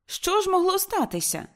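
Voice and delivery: very high startled question; alarmed. Acted. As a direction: gasp it at the daughter.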